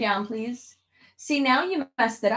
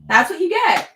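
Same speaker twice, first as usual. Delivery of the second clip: teasing; 11 semitones higher, 8 dB louder than usual. Real, not acted. Teasing the pet after fall.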